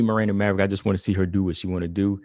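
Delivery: normal talking voice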